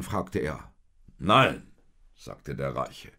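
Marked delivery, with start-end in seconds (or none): 1.2-1.7 firm refusal. A narrator's reading. Rich man refuses.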